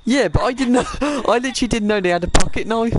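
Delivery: giggling high defense; laughing protest. Real, not acted. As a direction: laugh through the excuse.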